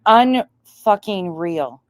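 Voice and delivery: normal talking voice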